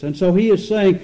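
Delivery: ordinary talk, nothing marked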